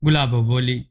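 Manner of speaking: ordinary delivery, nothing marked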